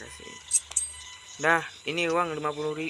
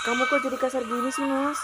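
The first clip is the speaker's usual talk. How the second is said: upset wife; 7 semitones higher than usual. Acted. Wife upset in acted quarrel.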